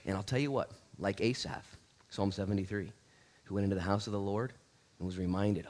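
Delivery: ordinary talk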